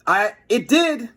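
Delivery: ordinary delivery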